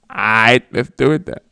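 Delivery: loud hammed-up approval; mock deal. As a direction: seal the deal loudly. President agrees in mock scene.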